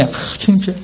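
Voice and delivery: softly acted shy reply; embarrassed. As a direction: shrink back, too ashamed to answer aloud. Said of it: "창피해서 말을 못해요".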